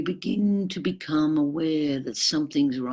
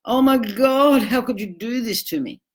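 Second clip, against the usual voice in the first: higher, louder mock-hurt cry on Oh my God; wounded outrage; 8 semitones higher, 4 dB louder than usual. Partner acting wounded to put you back in your box, hammed-up hurt. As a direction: play the injured victim to guilt them. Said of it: "in an effort to put you back in your box".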